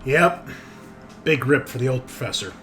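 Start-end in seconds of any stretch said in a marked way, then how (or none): none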